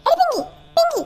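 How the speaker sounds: very high shrieking call; yelling name. Acted. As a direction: scream her name to jolt her awake. Yelling to wake sister. (ഉച്ചത്തിൽ അലറി വിളിക്കുന്നു)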